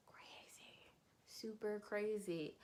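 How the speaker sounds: barely voiced whisper; whispered aside. Real, not acted. Commenting on a wild story, whispered.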